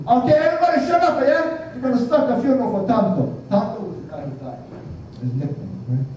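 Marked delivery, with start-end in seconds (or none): 0.0-3.2 high, fast, bossy call for silence; Samoan guy hushing the crowd. Retold or imitated. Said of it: "So he said".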